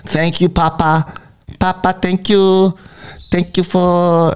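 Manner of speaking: put-on high, slow childlike thanks; grateful unborn kids. Unborn kids thanking their papa, high slow childlike voice. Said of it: "write me letters every day thanking me".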